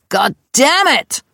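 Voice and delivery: langsames, höheres, lautes „God damn it!“; lauter Fluch. Retold or imitated. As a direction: ruft frustriert aus dem Nebenzimmer. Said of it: "Da hörte ich". (höher und lauter gezogener Fluch)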